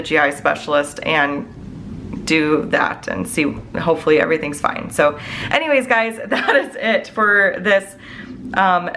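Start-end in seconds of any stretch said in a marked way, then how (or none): 5.5-8.0 laughing it off. Real, not acted. Laughs while wrapping up video.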